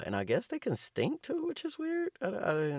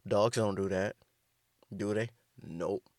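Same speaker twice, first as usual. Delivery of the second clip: usual speaking voice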